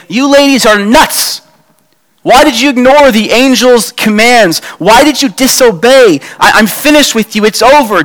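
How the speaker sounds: Jesus hamming up a rebuke he never gave; mock scolding. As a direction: scold them like a fed-up teacher. Imagined Jesus scolding the women.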